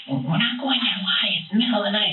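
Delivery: high, pleading retold protest; mock crying wife. Wife pleading with husband in car, imitated high and tearful. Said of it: "she just started bawling, crying".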